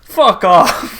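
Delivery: mock brazen Ford; brash defiant shout. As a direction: bark it out like a cocky mayor. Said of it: "He's like".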